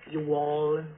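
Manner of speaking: "You all." drawn out like an American Southerner; southern drawl. Shown as a demonstration. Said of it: "Spanish with a southern accent".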